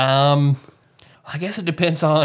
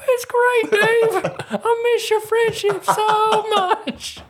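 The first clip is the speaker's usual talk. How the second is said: much higher, slow, laughing ghost voice; high ghostly Tyler; much slower than usual. Pretend. Podcaster voicing his dead cohost from heaven, high, slow and giggly. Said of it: "It's me doing both of our voices".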